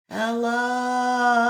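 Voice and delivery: distant echo. A narrator's reading. Echo answering the boy from the far hill, distant. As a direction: answer like an echo across a valley. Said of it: "from across the valley".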